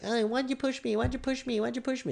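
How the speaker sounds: a sleazy photographer playing the victim; mocking a paparazzo whining about being pushed; whiny paparazzo.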